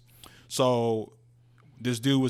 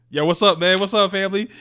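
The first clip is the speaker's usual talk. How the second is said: louder, higher imitation of greeting close friends; familiar hearty greeting; 7 semitones higher, 7 dB louder than usual. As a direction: greet them like family, voice lifted. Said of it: "dapping them up and shit like that".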